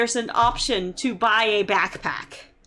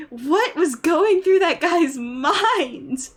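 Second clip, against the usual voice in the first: slow, higher, louder incredulous question; exasperated disbelief; 5 semitones higher, 4 dB louder, slower than usual. Real, not acted. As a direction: draw it out, utterly baffled. Still baffled by the phone user.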